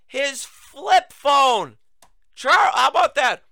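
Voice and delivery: loud, high and very slow; mock outrage. Host mocking the senator's old phone, loud and drawn out. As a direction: stretch it out in hammed-up disbelief.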